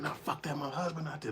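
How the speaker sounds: high-pitched, refusing to be held back; high mock woman insisting; defiant woman. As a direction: play her as she won't hear it. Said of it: "She's like".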